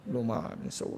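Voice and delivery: a light imitation of a cursing mother, trailing off; mock cursing mother. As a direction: fling an old-style curse half-laughing. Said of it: "엄마들이 막 그렇게". (웃음 섞인 욕하는 엄마 흉내)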